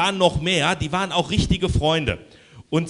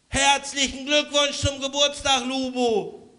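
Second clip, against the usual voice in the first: cheerful birthday shout; 10 semitones higher than usual. Acted. Family bursts in with birthday wishes.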